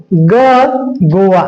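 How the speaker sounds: ग गोवा with a slow, drawn-out hard g; hard g sound. Shown as a demonstration. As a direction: stretch out ग in गोवा slowly. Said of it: "यहाँ पे ध्यान दीजिएगा". (ग को धीरे, खींचकर बोलें)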